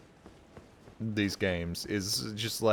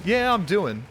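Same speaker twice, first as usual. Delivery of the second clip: grudging answer; 9 semitones higher, 6 dB louder, slower than usual. Retold or imitated. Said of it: "How you doing?".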